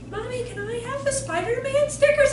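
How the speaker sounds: high-pitched little kid begging, acted; pleading child. Child asking mom for stickers, high eager plea.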